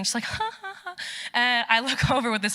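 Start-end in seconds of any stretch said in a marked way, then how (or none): 0.2-0.9 soft, high "ha ha ha"; imitated chuckle. Friend at the closed shop, imitated chuckling. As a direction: mimic a friend's light chuckle. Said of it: "she's, like, chuckling".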